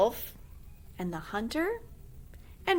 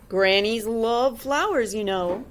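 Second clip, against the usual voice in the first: slow, loud, put-on wolf voice; scheming wolf; 7 dB louder, slower than usual. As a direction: coax her like a scheming wolf. The wolf speaks.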